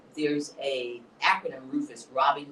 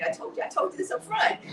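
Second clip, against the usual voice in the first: words breaking into real laughter, much higher; laughing. Real, not acted. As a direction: laugh through the line as you tell it. Laughing while retelling hospital.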